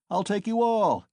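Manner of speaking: high, loud boastful shout. Acted. Mikolka boasting to the drunken crowd, loud high shout. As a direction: brag it at full voice. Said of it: "shouted one of them".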